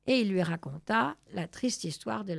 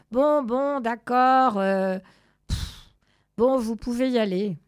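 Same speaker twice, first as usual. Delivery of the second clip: loud, exasperated giving-in with a puff; annoyed, louder concession; grudging surrender; 4 semitones higher, 8 dB louder than usual. Acted. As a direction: give in reluctantly, huffing. Chimpanzee gives in to the cat.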